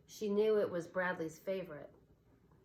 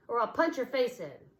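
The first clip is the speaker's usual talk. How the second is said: louder threat; angry threat; 5 semitones higher, 6 dB louder than usual. A narrator's reading. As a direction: threaten her. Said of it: "he snapped at her".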